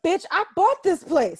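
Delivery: high, mock-outraged retort; hammed-up indignation; indignant comeback. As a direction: boss up and put her in her place. Acting out bossing up on her.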